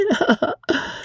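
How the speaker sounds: high silly titter; airy high chuckle; foppish laugh. Acted. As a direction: giggle like a careless dandy. Fop offers snuff, laughs.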